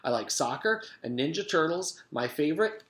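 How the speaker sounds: put-on a bouncy little girl's voice; small, energetic girl. A narrator's reading. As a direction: bounce it like a super ball. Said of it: "Her voice was small but full of energy".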